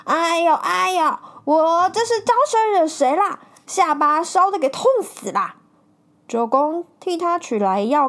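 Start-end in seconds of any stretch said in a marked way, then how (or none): 0.0-5.6 higher, whining moans of pain; complaining. A narrator's reading. Burnt traveller complains to friends, higher whining. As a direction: whine about your burnt chin. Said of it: "抱怨道说".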